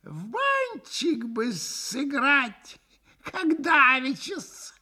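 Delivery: high, slow, giggly little voice; хихикая. A narrator's reading. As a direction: suggest it coyly in a drawn-out falsetto. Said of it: "хихикнул вдруг Максимов". (тоненько, с хихиканьем)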